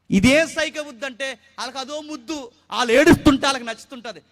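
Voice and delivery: loud, high, impassioned sermon delivery; fervent preaching. Acted. Pastor preaching to the congregation, loud and fervent.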